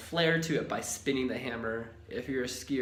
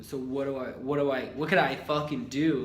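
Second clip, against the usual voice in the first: rising louder to an exasperated cry; building frustration; 3 semitones higher, 8 dB louder than usual. Real, not acted. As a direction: push each repeat higher and louder. Despair over the lost job.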